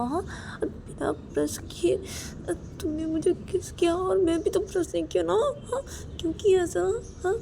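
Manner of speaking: high, whiny scolding voice; wife scolding husband for a morning kiss, high and whiny. Acted. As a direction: nag him, half disgusted. Wife scolds about unbrushed kiss.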